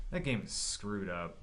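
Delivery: normal talking voice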